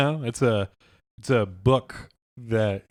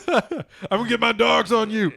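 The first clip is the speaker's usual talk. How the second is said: high mock threat through laughter; hammed-up villain threat, giggling; laughing threat; 9 semitones higher than usual. Acted dog man threat.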